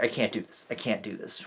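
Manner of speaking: defeated giving up. Retold or imitated. Writer imitating a despairing quitter, mock defeat. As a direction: fade out like someone giving up. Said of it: "They're just like".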